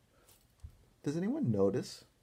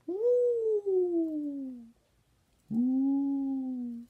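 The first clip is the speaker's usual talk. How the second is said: high, louder hooting ghost calls; ghost woo; 5 dB louder than usual. Pretend. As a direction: hoot twice up high like a playful ghost. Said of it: "We have a friend going".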